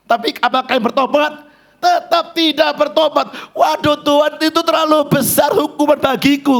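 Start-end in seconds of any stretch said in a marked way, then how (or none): 3.6-6.6 Cain protesting. Acted.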